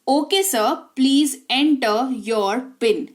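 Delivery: very slow, spelled-out English for practice; slow model sentence. Shown as a demonstration. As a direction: lay out every word slowly. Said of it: "teacher models the English line". (धीमी गति में अभ्यास वाक्य)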